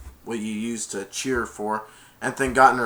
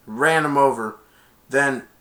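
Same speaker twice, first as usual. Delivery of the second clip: normal talking voice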